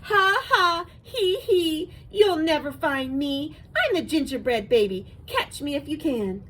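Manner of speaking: put-on high, loud gingerbread baby voice; teasing little cookie, chanting at full voice; gingerbread baby's singsong taunt. A narrator's reading. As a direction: crow it out in a gleeful high singsong. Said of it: "he sang at the top of his gingerbread voice".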